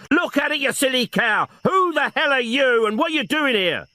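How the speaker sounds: high furious shout at her; angry shout. Acted. Detective shouting at old woman intruder, angrily.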